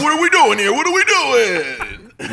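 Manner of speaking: loud, high exasperated dad; rousing sleepy kids, fed up; exasperated parent. Retold or imitated.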